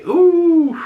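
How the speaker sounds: high, delighted swoon of an ooh; a thrilled, sky-high coo; a fan gushing at the name Halle Berry; excited ooh. Real, not acted.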